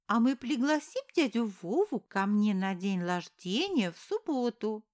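put-on chirpy little child voice; a happy toddler announcing plans; small boy voice.

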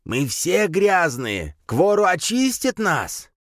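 high, slow zealot's cry; crazed fanatic. A narrator's reading. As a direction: proclaim it in mad rapture. Crazed fanatic goes on ranting. (безумный высокий голос фанатика)

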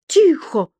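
high, sharp cry for silence; furious. A narrator's reading. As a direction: demand silence in a rage. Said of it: "в ярости закричал старший преподаватель".

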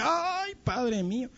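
high, soft wail to God; pained cry to God. Retold or imitated. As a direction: mimic a soft "ay" of pain, falling away. Recalls pain of being stitched.

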